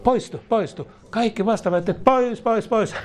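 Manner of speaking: high, insistent command to go; commanding rebuke. Acted. Preacher commanding the soul's objections away, high insistent rebuke. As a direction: drive the objections out.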